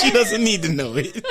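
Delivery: laughing. Real, not acted. Repeating with laughter.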